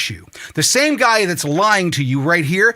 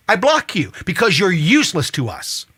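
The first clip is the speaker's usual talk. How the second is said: usual speaking voice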